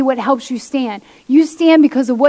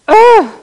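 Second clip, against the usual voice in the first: frustrated ugh; 8 semitones higher, 8 dB louder than usual. Retold or imitated. Frustrated groan opening mock quote.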